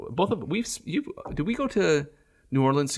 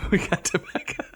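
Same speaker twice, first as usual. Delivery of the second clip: laughing; 6 semitones higher than usual. Real, not acted. Laughing repeat of tobacco motto.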